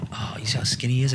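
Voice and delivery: quick hushed aside, voiceless; mock whisper. Retelling a friend's hushed remark.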